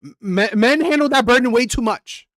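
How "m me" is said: ordinary talk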